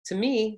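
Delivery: "me" lifted high mid-sentence; rising intonation on ME. Shown as a demonstration. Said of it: "see how I go up".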